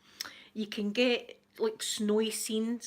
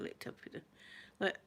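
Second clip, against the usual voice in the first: ordinary delivery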